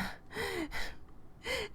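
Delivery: breathy high giggle; a flustered high laugh; high nervous laugh. Real, not acted. Streamer laughing nervously at Neil's affection. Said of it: "This is making me feel bad".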